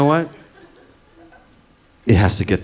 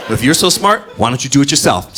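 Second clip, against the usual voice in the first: sarcastic challenge; 6 semitones higher, 8 dB louder than usual. Retold or imitated.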